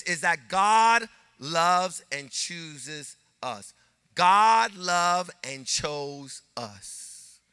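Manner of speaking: normal talking voice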